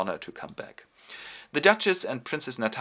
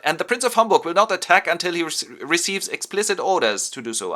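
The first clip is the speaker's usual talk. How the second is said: loud, higher-pitched command; field marshal's order; 8 semitones higher, 8 dB louder than usual. Retold or imitated. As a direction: issue orders to the whole room.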